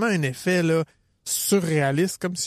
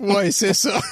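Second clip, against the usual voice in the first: higher, louder eager yes, a little hammed-up; reply too eagerly, playing it up; eager mock reply; 4 semitones higher, 4 dB louder, slower than usual. Answering the amused police officer, mock eager. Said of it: "Je fais".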